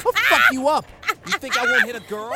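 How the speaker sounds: loud, fast threatening yell; angry threat. Acted. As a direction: shout the threat.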